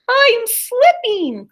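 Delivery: high, slow, stretched-out cat screech; terrified drawn-out screech from the branch. Acted. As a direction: wail it like a cat losing its grip. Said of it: "Clevis screeched".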